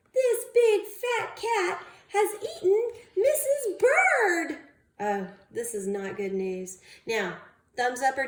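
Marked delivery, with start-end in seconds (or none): 0.1-4.6 crying out. Acted. Bird sure the cat ate his wife. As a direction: wail out the loss. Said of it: "he cried".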